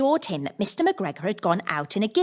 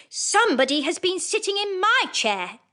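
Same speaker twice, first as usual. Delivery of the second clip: higher, medium bear voice; middle-sized bear voice; 11 semitones higher than usual. Acted. Middle bear notices her chair, higher voice. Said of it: "in his middle-sized voice".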